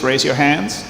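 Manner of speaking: normal talking voice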